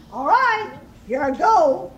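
loud wolf announcement; wolf about to blow. A narrator's reading.

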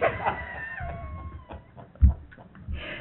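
very high, shrieking burst of laughter; helpless laugh. Retold or imitated. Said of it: "She's killing herself laughing".

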